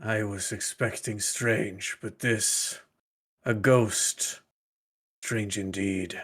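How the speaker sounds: low muttered knight voice, slow and quiet; under his breath. Acted. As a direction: murmur it to yourself, unsettled. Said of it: "Alistair sort of under his breath".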